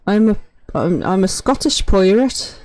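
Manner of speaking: loud Scottish pirate slipping into Liverpudlian; Scottish turned Liverpudlian. Acted. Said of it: "I'm apparently Liverpudlian".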